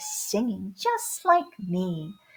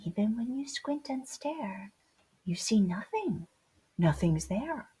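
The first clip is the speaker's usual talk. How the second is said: whispering; 3 semitones lower, 7 dB quieter than usual. A narrator's reading. Sneaking cheetah, hushed.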